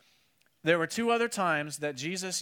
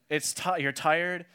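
ordinary talk

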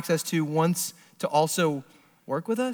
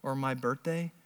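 gentle plea; 3 semitones lower, 8 dB quieter than usual. Pretend. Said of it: "He's saying".

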